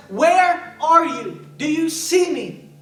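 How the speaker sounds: high, drawn-out anguished call; loud pleading call to someone absent; pleading cry. Acted. Preacher cries out a plea.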